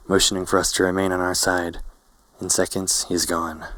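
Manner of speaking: plain reading voice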